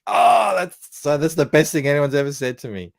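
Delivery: high, loud delighted outburst; delighted gushing. Retold or imitated. Retelling delight at the German's compliment, high and gushing. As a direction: burst out thrilled, then settle slightly.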